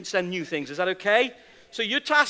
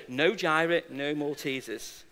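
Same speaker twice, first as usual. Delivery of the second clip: firm weary no; 7 semitones lower, 7 dB quieter than usual. Retold or imitated. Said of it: "She must have asked me five times".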